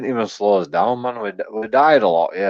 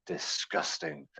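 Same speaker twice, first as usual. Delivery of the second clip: slow, breathy mock-disgusted whisper; 6 semitones lower, 18 dB quieter, slower than usual. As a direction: whisper it, pretending to be scandalised. Mock outrage at lockdown shagging.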